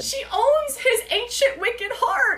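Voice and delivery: high, slow gushing. Real, not acted. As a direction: swoon over the words. Gushing over quote.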